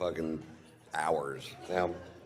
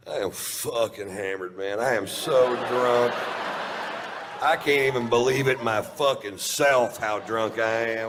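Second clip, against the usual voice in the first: put-on drunken confession; 4 semitones higher, 6 dB louder than usual. Comic confessing his secret to the crowd, loud drunken boast. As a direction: boast it loudly, leaning on the big words.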